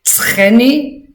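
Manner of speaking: normal talking voice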